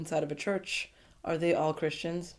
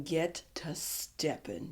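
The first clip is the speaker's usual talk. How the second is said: slow, cold whispered dismissal; quiet slow brush-off; 4 semitones lower, 9 dB quieter, slower than usual. Pretend. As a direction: whisper it slowly like a final verdict. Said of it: "I would have told him".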